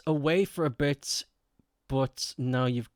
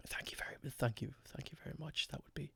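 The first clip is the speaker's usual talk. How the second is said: very soft, voiceless thanks; hushed whisper; 18 dB quieter, faster than usual. Pretend. Streamer thanking Jackie for adding ears, hushed whisper. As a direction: drop right down into a whisper.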